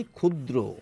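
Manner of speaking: "ক্ষুদ্র" said khudro, opening on a plain খ; kh sound at start. Shown as a demonstration. Teacher shows ক্ষ at a word's start, said as খ. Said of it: "খ দিয়ে প্রকাশ করি আমরা".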